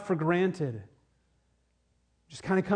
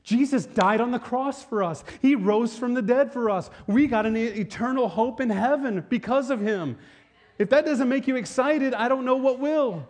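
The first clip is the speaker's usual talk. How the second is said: loud, high-pitched impassioned proclamation; urgent, exultant pulpit cry; full-force preaching; 7 semitones higher, 5 dB louder than usual. Real, not acted. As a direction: preach it out with rising fervor. Preacher builds to a peak.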